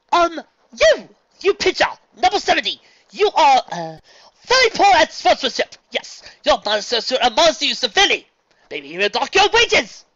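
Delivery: high, loud stilted heckle; awkward shouting. Acted. Luna trying out heckling the pitcher, loud formal shout. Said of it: "better at shouting".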